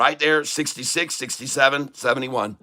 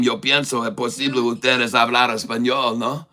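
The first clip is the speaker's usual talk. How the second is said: broken Spanish; 4 semitones lower, 4 dB quieter than usual. Acted. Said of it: "with that last name".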